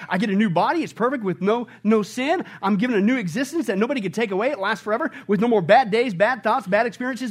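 ordinary delivery

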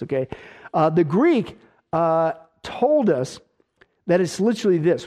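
ordinary talk

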